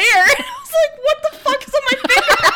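high laughing mock alarm. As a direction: squeal it out mid-laugh. Retelling surprised reaction.

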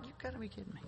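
very soft, under-the-breath grumble; exasperated aside, barely voiced; muttered disbelief. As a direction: grumble it quietly to yourself in disbelief. Retelling his muttering behind the bus.

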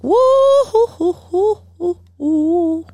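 high, drawn-out woo-hoo-hoos; string of high-pitched hoots; excited whooping. Real, not acted. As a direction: whoop in a high, hooting run. Reacting to the third book.

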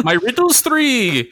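high, drawn-out fantasy gatekeeper voice; mock riddle keeper. Host joining the young-traveler riddle bit, mock riddle keeper. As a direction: pose it slowly like a bridge troll's challenge.

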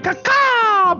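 high crow caw-caw call; chirping bird person. Pretend. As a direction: squawk like a crow.

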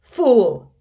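loud, high roar of scorn; villain's furious bark; terrible voice. A narrator's reading. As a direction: blast the word at the underling. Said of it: "in his most terrible voice".

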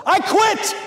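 high, loud cry of surrender; yelled quit. Retold or imitated. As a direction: yell it like a beaten boxer giving up. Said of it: "he yelled these two words out".

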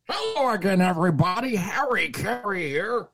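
high-pitched, hammed-up sportscaster greeting; bad Harry Caray. Podcaster clowning through a dull game, bad announcer impression. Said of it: "shittiest Harry Caray impression I've ever done".

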